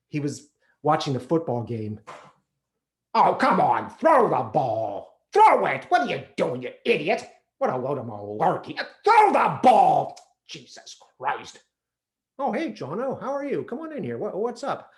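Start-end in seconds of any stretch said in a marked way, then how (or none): 3.1-10.2 father yelling at TV. Retold or imitated. Father watching football yells at the TV players, loud and angry. 10.5-11.6 disgusted mutter. Retold or imitated. Father muttering at game. 12.4-14.9 high, bright switch to friendly; sudden cheery father. Retold or imitated. Father turns from the game to greet his son, high and cheery.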